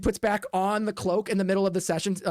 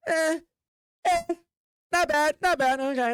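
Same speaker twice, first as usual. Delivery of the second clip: lukewarm crowd; 7 semitones higher than usual. Acted.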